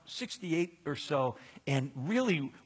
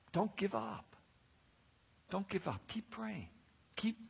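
much softer, hushed plea; soft urging; 5 semitones higher, 9 dB quieter than usual. Retold or imitated. The sister urging the congregation to keep praying, hushed plea. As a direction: press it gently, almost under breath. Woman's urging, retold softly.

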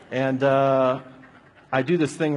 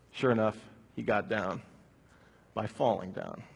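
ordinary talk, nothing marked

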